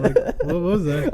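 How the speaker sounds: slow, giggly retelling; laughing through a quote. Retold or imitated. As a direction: laugh out the confused waiter's words. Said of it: "He's like".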